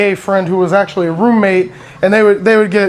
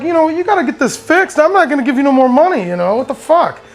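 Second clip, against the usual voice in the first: telling off landlady; 6 semitones higher, faster than usual. Retold or imitated. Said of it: "I tell the lady, I'm like".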